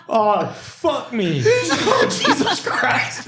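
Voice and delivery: high, slow, laughing disgust; drawn-out laughing groan of disgust. Retold or imitated. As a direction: laugh out the horror of it. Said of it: "And I'm like".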